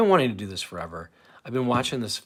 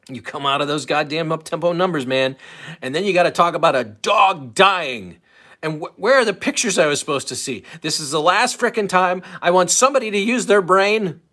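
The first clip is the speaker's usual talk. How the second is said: louder, higher mock tirade; mock angry rant; 5 semitones higher, 7 dB louder than usual. Streamer reenacting a radio host's outtake, mock angry rant. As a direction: ham up an exasperated host blowing up.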